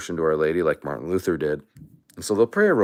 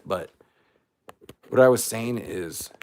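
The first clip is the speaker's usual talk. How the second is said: normal talking voice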